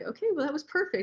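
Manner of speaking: pleased reply. Retold or imitated. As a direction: toss it off brightly and fast. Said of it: "you're like".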